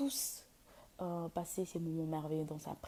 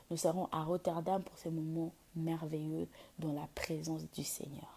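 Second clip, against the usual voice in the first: normal talking voice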